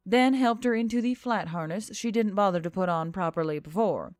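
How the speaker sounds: ordinary delivery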